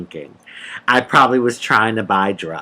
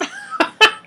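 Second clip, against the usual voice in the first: high-pitched laugh; laughter. Real, not acted. Laughs at own joke.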